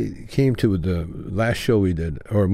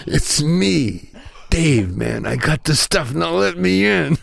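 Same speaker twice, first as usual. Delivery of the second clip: raised, mock-irritated voice, slowing at the end; a little angrier; 8 semitones higher, slower than usual. Cheech, locked outside, demands entry, acted a little angrier. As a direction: act out growing impatience to be let in. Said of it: "This time he's a little angrier".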